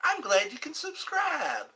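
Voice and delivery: high southern thank you; high sweet southern accent. Pretend. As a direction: keep the southern accent going. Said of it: "There's my southern accent".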